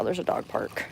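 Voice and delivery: breathy unvoiced aside; whisper. Real, not acted. Walking past the dog park, whispering to the camera.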